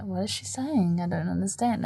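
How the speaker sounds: hushed mocking quote. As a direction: say it under your breath, as an aside. Retold friends muttering about her mum.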